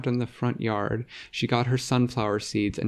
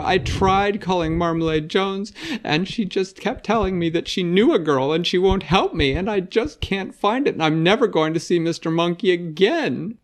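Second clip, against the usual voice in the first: higher, near-tears complaint; tearful girl; 8 semitones higher, 5 dB louder than usual. Acted. Vera near tears tells her brother her troubles. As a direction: pour out the woes on the verge of crying.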